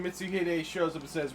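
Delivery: ordinary delivery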